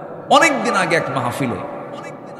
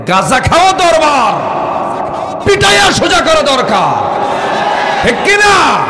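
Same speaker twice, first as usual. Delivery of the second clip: much higher, loud angry outcry; furious shout; 12 semitones higher, 7 dB louder than usual. Acted. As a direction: rage at the fake shrines. Preacher rails against fake shrines. (ক্রুদ্ধ চিৎকার)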